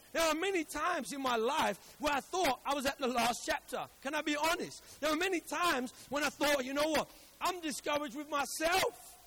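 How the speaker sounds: forceful sermon delivery with punched key words; emphatic, projected pulpit voice; impassioned preaching. Acted. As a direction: preach it out to the whole congregation.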